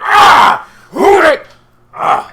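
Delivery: high, loud outburst; startled yell. Acted. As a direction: yelp like you've just been smacked. Acting the startled smacked crowd.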